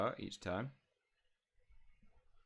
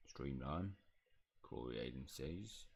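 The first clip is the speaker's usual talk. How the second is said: low, soft house elf; hushed, doubtful creature voice; Pitts; 6 semitones lower, 7 dB quieter, slower than usual. Acted. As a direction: puzzle over it slowly in a low elf voice. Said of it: "elf voice".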